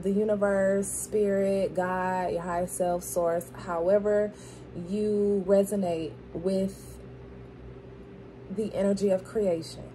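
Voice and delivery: normal talking voice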